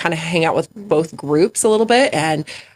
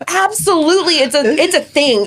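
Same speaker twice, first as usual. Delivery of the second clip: high bright insistent agreement; playful agreement; 7 semitones higher than usual. Retold or imitated. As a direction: sell it to the kid playfully. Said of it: "And I was like".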